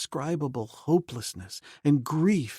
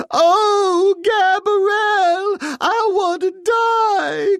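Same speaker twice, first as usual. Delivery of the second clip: very high, slow wailing cry; high slow sobbing wail; wailing maid; 8 dB louder, much slower than usual. A narrator's reading. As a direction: wail in terror with eyes shut. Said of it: "cried the poor woman".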